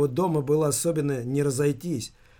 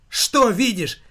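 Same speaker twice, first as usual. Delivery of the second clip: higher, louder agitated cry; hysterical shout; 7 semitones higher, 6 dB louder, slower than usual. A narrator's reading. Son snaps back at his accusing mother, hysterical shout. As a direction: fire the question back hysterically. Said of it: "не менее истерично закричал он".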